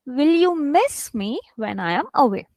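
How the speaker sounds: deliberate model stressing "miss". Shown as a demonstration. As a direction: punch "miss", pause, let the rest fall. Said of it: "Here I'm stressing".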